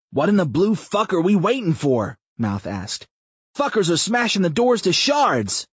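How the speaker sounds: high, loud exasperated yelling; impatient outburst. A narrator's reading. Mouth yelling at Chris while the doors break, loud and high. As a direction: demand an answer now.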